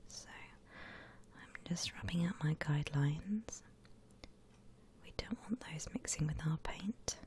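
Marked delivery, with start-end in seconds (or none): none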